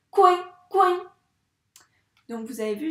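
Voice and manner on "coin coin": loud, high duck call; exclaimed quack. Shown as a demonstration. Teacher showing exclamation marks to pupils, loud high quack. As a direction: belt out the quacking, much louder and higher. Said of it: "j'ai accentué là où il y avait des points d'exclamation".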